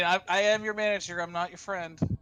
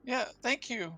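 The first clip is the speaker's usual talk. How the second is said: higher, timid stammer; shaky young woman; 8 semitones higher than usual. Acted. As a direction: shaken prisoner thanking. Hannah grateful but shaken.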